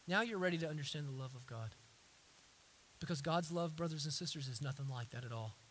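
ordinary talk, nothing marked

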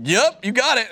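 bright, high and loud yes to the waitress; jumping up in pitch and volume; eager yes. Retold or imitated. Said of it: "and I was like".

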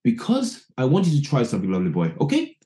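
casual manager voice, a rising okay at the end; offhand put-on manager; imitating Ruud Gullit. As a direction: manager dismissing the player and walking off. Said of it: "as he's saying".

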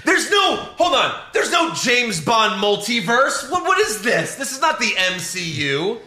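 high, loud disbelieving protest; incredulous outburst. Real, not acted. Friend ranting at the podcast co-host, high and loud. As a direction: cry out in laughing disbelief. Laughing outburst at the reboot news.

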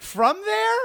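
very high, disbelieving question; comic squeal of astonishment; mock incredulous. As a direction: imitate a fan who can't believe it. Retold fan's astonished question.

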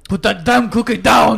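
shouted action hero movie quote; Arnold Schwarzenegger impression. Quoting Arnold in Jingle All the Way.

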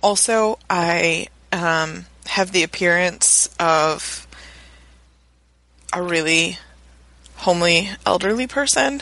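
husky, rough voice from a cold; hoarse sick voice. Real, not acted. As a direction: rasp through a sore throat. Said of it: "that is a good voice".

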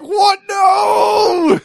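high, loud wail of dismay; retold horrified shout. Player reliving rockets hitting him, high mock-horrified wail. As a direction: cry out as if doom is falling from the sky. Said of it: "I was just like".

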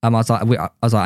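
ordinary talk, nothing marked

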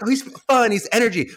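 high, bouncy and energetic; bubbly fun voice. Retold or imitated. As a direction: pitch it up, all fun and energy. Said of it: "you think he's like".

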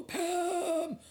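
high-pitched old newspaper editor impression; put-on high, wavering old-guy voice; Newt Falls. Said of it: "he talked like that".

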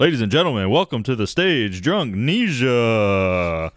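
mock announcer with a long drawn-out name; stage announcer. Announcer bringing Drunknesia to the stage, hammed-up introduction. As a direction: announce the act, stretching the final name right out.

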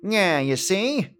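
lightly voiced old-time mobster; 1920s gangster. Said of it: "like they were a nineteen twenties gangster".

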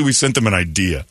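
ordinary talk, nothing marked

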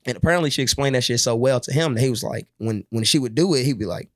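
ordinary talk

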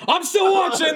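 high, loud imitated shout; Chadwick Boseman. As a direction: yell it way up high, as a gag. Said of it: "he shouts".

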